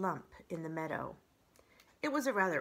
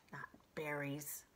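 whispered; 4 semitones lower, 10 dB quieter than usual. Acted. Fox mutters.

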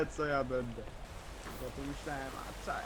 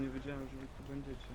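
ordinary delivery

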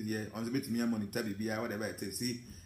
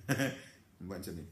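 slow, laughing half-sentence; 5 semitones lower, 4 dB quieter, much slower than usual. Real, not acted. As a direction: let the laugh take over the words. Laughs off his own agitation.

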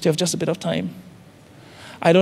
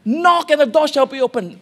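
lifted higher, ringing out the verse; proclaimed scripture; 7 semitones higher than usual. Acted. Preacher proclaiming scripture to the congregation, raised and ringing. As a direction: proclaim it like holy writ.

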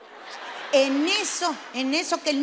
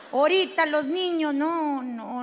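usual speaking voice

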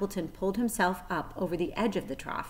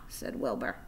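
ordinary delivery, nothing marked

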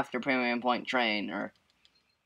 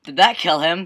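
ordinary talk

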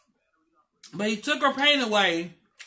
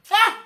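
short laugh; 12 semitones higher, 7 dB louder than usual. Retold or imitated. Laughing at the death angel joke.

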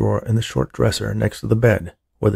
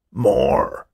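put-on old man's demand; 3 semitones higher than usual. A narrator's reading. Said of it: "Old Man Finster screamed".

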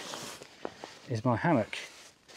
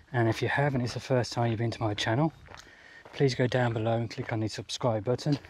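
usual speaking voice

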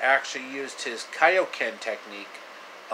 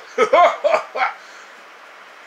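very high, loud cartoon laughter; squeaky laugh; 8 dB louder than usual. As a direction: cackle squeakily.

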